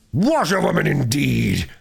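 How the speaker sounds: slow, scornful mock shout; reckless shout. A narrator's reading. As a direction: sneer it out with glee. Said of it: "he shouted recklessly".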